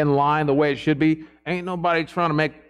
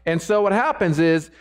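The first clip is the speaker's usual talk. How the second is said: ordinary delivery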